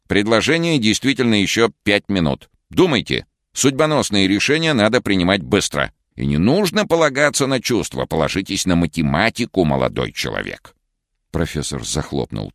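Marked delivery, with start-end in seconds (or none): 0.1-10.7 put-on a stern old professor, a little higher. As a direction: lecture him like a smug old professor. Professor voiced in story.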